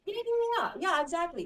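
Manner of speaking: hammed-up delighted director agreeing to anything; mock clueless director. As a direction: gush approval like a director who missed the point. Mocking clueless director's approval.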